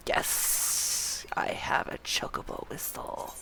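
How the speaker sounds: soft, drawn-out whisper; gleeful whisper. Pretend. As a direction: whisper it to yourself, savoring the prize. Gloats softly over new whistle.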